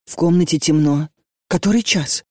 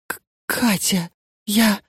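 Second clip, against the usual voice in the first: broken, breathless after sobbing; 3 semitones higher, slower than usual. A narrator's reading. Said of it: "прерывисто выдохнула она".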